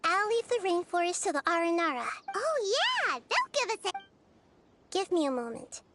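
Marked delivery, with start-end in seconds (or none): 2.3-4.0 high, eager chirp; excited agreement. Acted. Companion welcoming the Aranara's help, excited.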